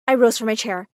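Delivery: plain reading voice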